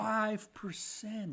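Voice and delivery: very slow, stretched-out amazement; drawn-out disbelief. Real, not acted. Host amazed at new down payment rule, drawn out. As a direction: stretch each word as if astounded. Mock amazement, repeats figure.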